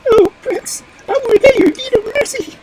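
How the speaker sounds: very high, loud squeaky bird; high-pitched pigeon squeal of gratitude; grateful wood pigeon. Acted. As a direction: pipe up earnestly as a thankful little pigeon. Grateful pigeon promises repayment.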